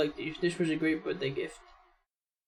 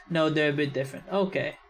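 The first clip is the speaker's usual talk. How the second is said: ordinary delivery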